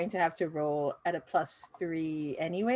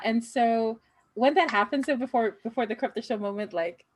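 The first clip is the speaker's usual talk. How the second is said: ordinary talk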